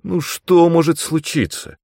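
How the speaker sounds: high, coaxing question; pleading. A narrator's reading. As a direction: plead as if nothing could go wrong. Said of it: "взмолился я".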